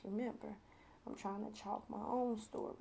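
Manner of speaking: usual speaking voice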